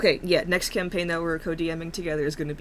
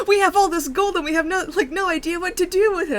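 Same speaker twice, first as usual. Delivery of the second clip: excited; 14 semitones higher, 9 dB louder, faster than usual. Retold or imitated. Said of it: "You guys are like".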